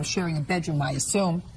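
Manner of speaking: ordinary talk, nothing marked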